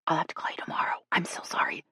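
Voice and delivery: hushed, apologetic whisper. A narrator's reading. Jill ending her phone call, whispering. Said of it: "Jill whispered".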